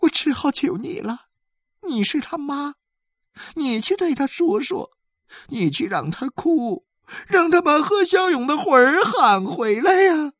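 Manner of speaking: high-pitched pleading; tearful begging. A narrator's reading. Woman begs the boy's mother.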